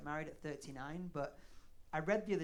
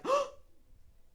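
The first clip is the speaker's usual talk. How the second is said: mock gasp; 7 dB louder than usual. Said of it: "she'd be like".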